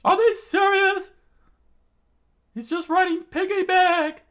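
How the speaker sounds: very high loud shriek; comic shriek; screamed. Acted. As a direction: shriek in disbelief. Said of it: "Sanji screamed out".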